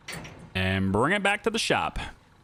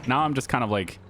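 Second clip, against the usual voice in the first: ordinary talk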